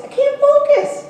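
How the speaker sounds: hammed-up frustrated plea, slow and high; mock whine. Real, not acted. Complaining to chatty audience.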